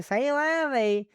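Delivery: high, slow Southern drawl; Southern accent. Acted. Quoting the line that cracked them up, in a Southern accent. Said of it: "in a southern accent".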